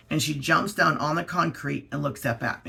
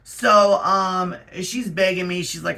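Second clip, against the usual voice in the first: ordinary talk, nothing marked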